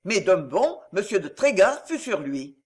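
plain reading voice

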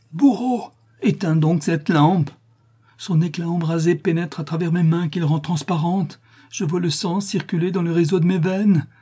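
high, anguished accusation; shrill, terrible cry. A narrator's reading. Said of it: "Un nouveau cri aigu, terrible".

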